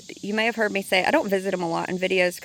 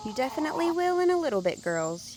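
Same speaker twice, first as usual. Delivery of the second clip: higher, quicker pet-talk voice; talking to the chickens; 7 semitones higher, 3 dB quieter than usual. Real, not acted. Gardener promising her hens scraps, in a higher quick pet voice. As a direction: coo at the hens following you.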